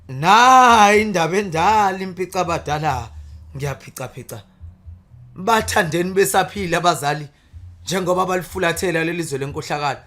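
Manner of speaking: loud declaimed praise poetry; poem recitation. Acted.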